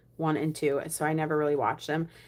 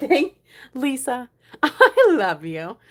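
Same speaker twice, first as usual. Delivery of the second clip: louder, slow, laughing gratitude; laughing thanks; 7 semitones higher, 8 dB louder, slower than usual. Real, not acted. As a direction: laugh through the thank you. Laughing thanks to a helpful commenter.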